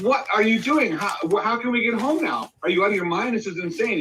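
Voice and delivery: high, frantic wife's voice; shrill disbelief at her husband; panicked wife. Retold or imitated. Wife in the joke screaming at her husband, frantic. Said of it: "And the woman says".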